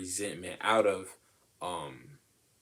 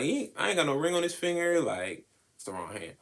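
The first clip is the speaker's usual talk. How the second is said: high, fast taunting brag; mock sassy boast; sassy defiance; 9 semitones higher, 4 dB louder, faster than usual. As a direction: flaunt the bare finger, quick and high. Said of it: "so it's like".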